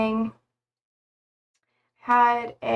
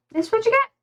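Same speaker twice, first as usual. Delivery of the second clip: much higher, playful sing-song; high sing-song reveal; 12 semitones higher, much faster than usual. Pretend. Streamer showing her messy look, high and sing-song. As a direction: present it like a silly reveal to chat. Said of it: "repeats, higher and sing-song".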